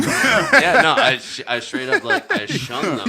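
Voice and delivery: laughing hard while talking; words bubbling with laughter; giggling through the answer. Real, not acted.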